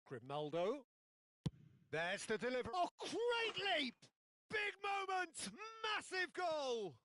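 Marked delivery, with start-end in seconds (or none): 2.8-6.9 excited goal call. Acted. Goal called in match commentary.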